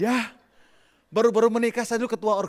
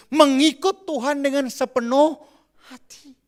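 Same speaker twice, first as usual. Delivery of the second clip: preaching emphasis; 7 semitones higher, 7 dB louder, slower than usual. Acted.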